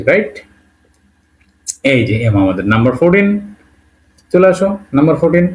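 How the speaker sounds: ordinary talk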